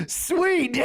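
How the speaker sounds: a high, laughing "sweet"; laughing mock delight. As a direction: squeak it out through laughter. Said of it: "I'm like".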